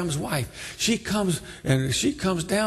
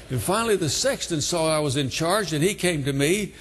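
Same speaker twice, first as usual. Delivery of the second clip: usual speaking voice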